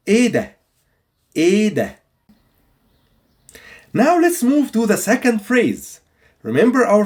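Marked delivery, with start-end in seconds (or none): 0.1-2.0 "إيه ده" with long "ee" and silent H; "إيه ده" lifted, vowel gliding straight into "da"; repeat-after-me model. Shown as a demonstration. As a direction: model "إيه ده" clearly for learners, no h sound. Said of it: "say it with me".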